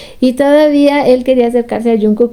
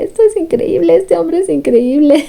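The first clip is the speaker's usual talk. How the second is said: amazed; 6 semitones higher than usual. Retold or imitated. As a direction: gush "esto es increíble" like you can't believe it. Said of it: "Y [name] así como".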